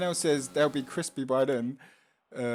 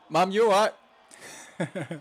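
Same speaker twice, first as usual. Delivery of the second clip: louder, higher call through the house; 7 semitones higher, 4 dB louder, slower than usual. Real, not acted. Streamer checking on his mum after the alarm, calling out. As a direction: call out to mum in another room.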